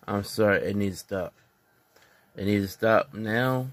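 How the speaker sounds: ordinary delivery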